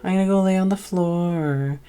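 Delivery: a hint of a spoiled cat voice. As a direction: whine like a picky kitty. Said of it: "he was like".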